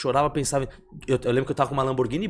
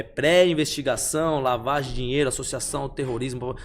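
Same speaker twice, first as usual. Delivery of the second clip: ordinary talk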